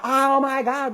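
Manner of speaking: high, loud imitated panic; panicking. Mom finds strangers with her children, retold panicked cry. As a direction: exclaim like an alarmed mother, high and loud. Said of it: "and she's panicking".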